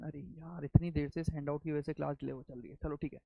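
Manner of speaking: ordinary delivery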